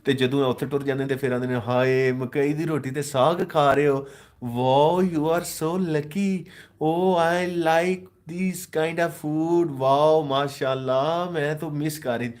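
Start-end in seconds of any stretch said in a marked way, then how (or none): 1.5-12.3 high, slow, gushing over the food; mock gushing expat. Mocking expats who swoon over village food, high and gushing. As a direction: ham up a homesick expat swooning over saag. Mocks overseas Pakistanis gushing.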